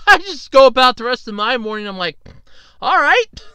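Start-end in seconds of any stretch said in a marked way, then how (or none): 2.8-3.4 a high, loud mock-breezy "All right."; sarcastic all right. Sarcastic reply to the repairman.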